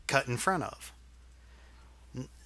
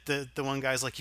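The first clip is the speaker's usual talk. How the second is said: ordinary talk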